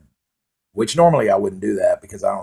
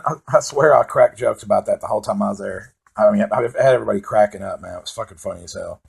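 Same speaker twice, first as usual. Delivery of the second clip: ordinary talk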